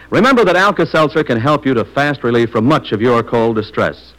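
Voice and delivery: ordinary talk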